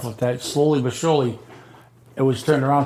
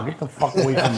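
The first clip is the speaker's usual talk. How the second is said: laughing brush-off; faster than usual. Retold or imitated. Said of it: "I said".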